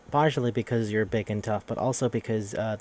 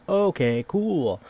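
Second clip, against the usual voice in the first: drawn-out, high, loud "okay, cool"; dubious okay; 7 semitones higher, 4 dB louder, much slower than usual. Retold or imitated. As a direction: stretch it out, weirded out but going along. Reacting to weird cousin news.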